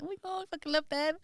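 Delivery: high, hushed gush of a football-fan dad; imitating an awestruck dad, pitched right up; starstruck dad. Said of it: "Dad would be like".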